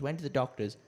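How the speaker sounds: ordinary talk, nothing marked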